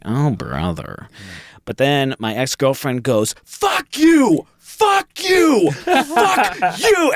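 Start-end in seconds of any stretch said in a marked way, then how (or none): 0.0-1.1 low, soft, slow aside; quietly exasperated; retold weary dismay. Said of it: "And I was like". 3.5-7.1 very high mock scream at a stranger; acted-out shrieked insult, breaking into laughter; retold screaming. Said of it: "they start screaming at each other".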